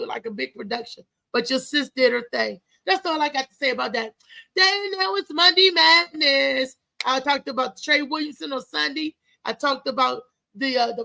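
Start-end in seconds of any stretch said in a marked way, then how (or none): 4.6-6.8 higher, louder and slow, announcing the segment with a punch; hype show intro. Real, not acted. Host to his viewers, opening the segment with a loud slow hype call.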